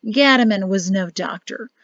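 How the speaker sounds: plain reading voice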